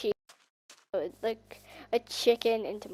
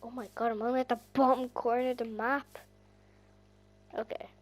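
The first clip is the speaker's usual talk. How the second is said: usual speaking voice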